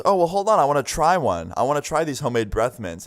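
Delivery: high-pitched, playful mom bluff; mock-innocent mom calling a bluff; faked eagerness. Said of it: "she's obviously faking it".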